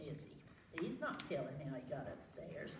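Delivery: soft quick confiding aside; hushed. A narrator's reading. Reader to children about the bear's secret, hushed aside.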